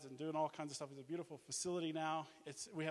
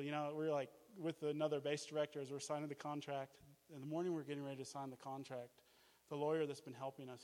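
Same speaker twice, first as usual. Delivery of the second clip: ordinary talk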